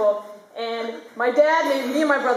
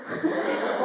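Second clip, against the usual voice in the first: soft laugh; 6 semitones higher, 3 dB quieter than usual. Real, not acted. Speaker laughs.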